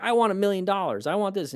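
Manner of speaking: high, whiny voice demanding money from God; childish demanding prayer. Retold or imitated. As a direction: pray like a greedy kid begging. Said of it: "like, the kid wanting candy".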